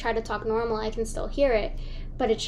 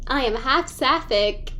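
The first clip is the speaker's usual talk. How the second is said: ordinary talk, nothing marked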